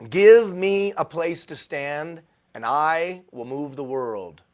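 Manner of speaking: slow, declaimed quote, loud at the start; grand quotation. Acted. Speaker reads out Archimedes' famous quote, slow and grand. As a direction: proclaim it slowly like a great saying.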